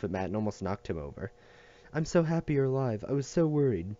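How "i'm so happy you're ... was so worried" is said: put-on higher, eager child voice; relieved little boy. A narrator's reading. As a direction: gush with relief. Boy relieved Matt alive.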